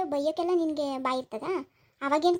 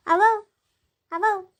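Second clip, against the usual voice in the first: calling out; 6 semitones higher, 8 dB louder, slower than usual. Real, not acted. Calling out to the old woman.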